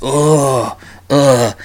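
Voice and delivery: usual speaking voice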